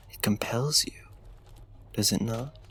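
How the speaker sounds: hushed, close whisper of flattery; seductive whisper. Acted. Flatters the captive up close, hushed whisper. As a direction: breathe it into her ear. Drawing her into his spell.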